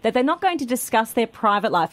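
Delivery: normal talking voice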